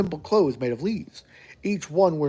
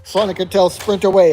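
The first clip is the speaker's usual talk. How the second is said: ordinary delivery, nothing marked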